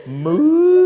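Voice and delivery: high mooing cow imitation; comic cow moo.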